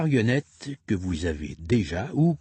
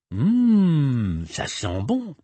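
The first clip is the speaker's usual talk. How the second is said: mock sniff of delight; 4 semitones higher, 4 dB louder, much slower than usual. Reader pretending to smell the flower for the child, mock delight.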